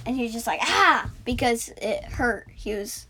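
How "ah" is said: mock scream.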